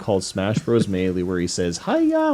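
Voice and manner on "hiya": very high-pitched attack cry; Star Fox. Retold or imitated. Explaining the fighting game catchphrase, high attack cry. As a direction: yelp it like a cartoon fighter striking. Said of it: "when he's attacking".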